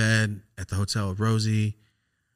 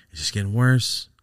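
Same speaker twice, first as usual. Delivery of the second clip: ordinary talk, nothing marked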